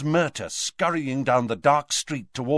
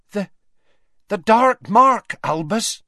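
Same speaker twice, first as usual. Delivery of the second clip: halting, high and shaken; terrified; 10 semitones higher, 8 dB louder than usual. Acted. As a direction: stammer it out in terror. Rosmerta terrified reporting the mark.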